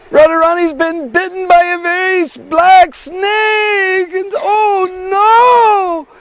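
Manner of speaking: high, loud, tearful wail; crying out in alarm. Acted. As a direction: cry out that Radharani was bitten. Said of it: "just crying and crying".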